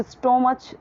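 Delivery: "स्टोमच" with long O and final CH; wrong pronunciation of stomach. Shown as a demonstration. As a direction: say "स्टोमच" as stoh-match. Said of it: "तो भी wrong हो जाएगा".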